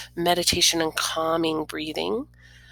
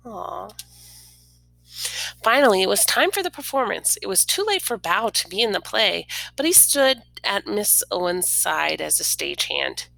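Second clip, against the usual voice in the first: ordinary delivery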